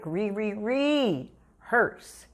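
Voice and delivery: Re, re, rehearse stretched very slowly with each re repeated; emphatic rehearse. Shown as a demonstration. Singing teacher drilling students on rehearse, slow emphatic stutter. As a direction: draw out "Re, re, rehearse" syllable by syllable. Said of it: "I made it really, really emphatic".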